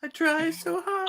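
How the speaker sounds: high, drawn-out whimper of failure; mock pleading. Joking with co-hosts about a failed accent, mock pleading.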